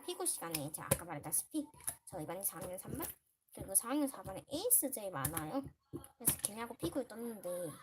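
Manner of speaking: normal talking voice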